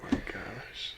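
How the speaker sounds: breathy, unvoiced oh my gosh; whispered disbelief. Real, not acted. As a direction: mutter it in a whisper. Reacting to the tea line.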